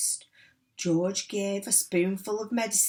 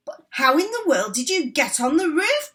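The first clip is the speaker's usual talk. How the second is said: crying out; 8 semitones higher, 6 dB louder, faster than usual. A narrator's reading. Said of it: "cried Mother".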